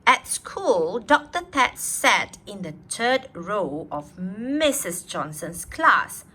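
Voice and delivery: usual speaking voice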